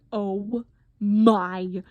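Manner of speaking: loud, mock-horrified outburst; retold terror. Patient staring at bloody gauze, mock terrified. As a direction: act out dread, drawing out the end. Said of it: "like, terrified".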